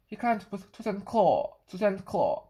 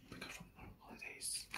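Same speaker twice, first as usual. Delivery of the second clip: a faint whisper; 25 dB quieter than usual. Acted. Narrator whispering that grandma is back, in a whisper. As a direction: breathe it out under the voice. Unvoiced aside.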